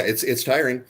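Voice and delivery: ordinary delivery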